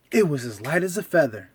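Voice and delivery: ordinary delivery, nothing marked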